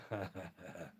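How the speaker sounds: quiet, low chuckle; soft chuckle. Real, not acted. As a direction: chuckle softly to yourself. Chuckles after teasing remark.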